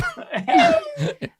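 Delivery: bagpipe squeal; high squeal laugh. Acted. Lulu squeezed under the arm, high bagpipe squeal.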